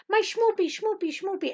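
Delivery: high-pitched mock pet-name cooing; Seinfeld lovey-dovey imitation. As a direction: coo it like a silly sweetheart. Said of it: "If any of you watch Seinfeld".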